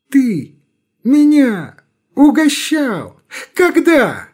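high, incredulous outburst; indignant surprise. Acted. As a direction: act amazed and offended. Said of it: "вдруг оживляется".

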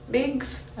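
Migs asked back with a high rising doubt; questioning echo. Real, not acted. Doubting the name he said.

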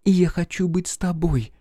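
high, fervent heroine's voice; frenzied confession. A narrator's reading. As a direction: confess it high, swept up in passion. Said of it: "иступлённо прошептала Катерина". (исступлённое признание высоким голосом)

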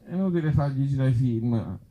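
ordinary delivery

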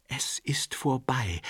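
slow, breathless whisper; barely audible words after the birth; exhausted whisper from the bed. A narrator's reading. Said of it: "lauteten die kaum vernehmlichen".

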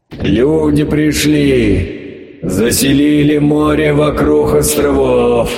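slow, otherworldly creature drawl; sea monster voice. Acted. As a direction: drag the words out like an ancient sea beast. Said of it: "сказала оно".

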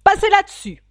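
high, loud royal order; commanding king. A narrator's reading. The king dismisses the quarrel, commanding loudly. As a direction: cut off the squabble loudly.

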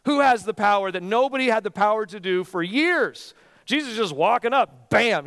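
loud, high, fervent pulpit voice; preaching at full force. Acted. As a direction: drive it home to the congregation.

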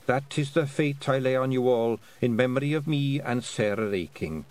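put-on a sly old Scottish matriarch sniffing out a treat; lightly voiced old Scottish woman, teasing; old Scots Kelda. Kelda's final decree.

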